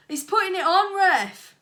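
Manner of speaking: loud, high whining protest; indignant protest. A narrator's reading. Opposing boy protesting to the referee. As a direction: insist to the ref he's faking. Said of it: "protested the Magdalen Street boy".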